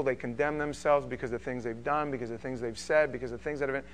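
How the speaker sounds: usual speaking voice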